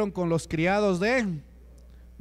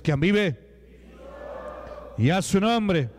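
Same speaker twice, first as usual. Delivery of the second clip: rousing call-out. Real, not acted. Preacher call and response.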